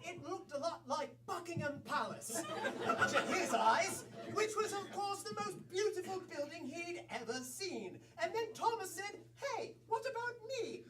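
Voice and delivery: put-on British accent. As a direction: narrate softly as a refined British storyteller.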